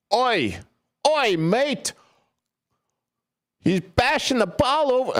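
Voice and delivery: put-on high, hammy Australian accent; a clumsy Crocodile Dundee Australian; bad Australian accent. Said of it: "what the hell kind of accent that was going to be".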